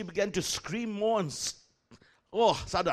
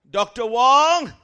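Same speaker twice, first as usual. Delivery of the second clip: loud drawn-out call of his name; 5 semitones higher, 12 dB louder, much slower than usual. Retold or imitated. Secretary calling the preacher, drawn-out loud call. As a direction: call him across the room, slow and loud.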